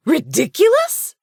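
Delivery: very high, loud indignant echo; escalating outrage. A narrator's reading. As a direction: fling back his word in outrage. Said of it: "Her voice escalated".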